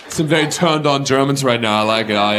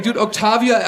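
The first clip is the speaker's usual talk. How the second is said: normal talking voice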